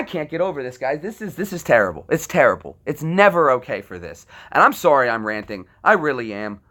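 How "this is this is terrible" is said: normal talking voice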